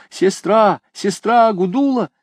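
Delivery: calling out. A narrator's reading. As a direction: call her loudly through the bars. Calling into the cell.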